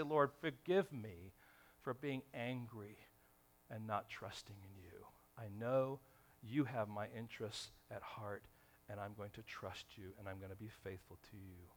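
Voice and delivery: hushed repentant prayer to the Lord; quiet prayer. Retold or imitated.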